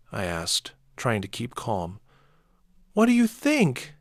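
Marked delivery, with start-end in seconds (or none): 2.9-3.9 screaming. A narrator's reading. Daughter screaming at her father. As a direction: scream it back at him. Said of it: "Izzy screamed".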